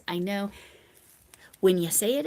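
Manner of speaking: normal talking voice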